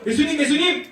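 lightly acted urgent call; Peter. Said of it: "베드로가 말했어요".

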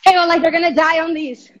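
loud, projected complaint; loudly repeating over a bad connection; raising voice to repeat. Real, not acted. Host could not hear, louder repeat.

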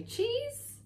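can't believe what he's hearing; dismayed. A narrator's reading. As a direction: brother baffled by a cheese gift for a tree. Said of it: "looked at her with dismay".

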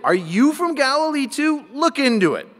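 high-pitched, mocking challenge; challenging retort. Pharisees replying to Nicodemus, scornfully challenging. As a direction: hurl the question back at a dissenter.

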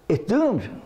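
normal talking voice

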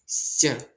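«ся» як м'який довгий звук; вимова «ся». Shown as a demonstration. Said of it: "ми його передаємо як". (м'яке подовжене «ся»)

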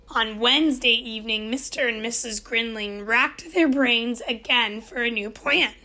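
ordinary delivery

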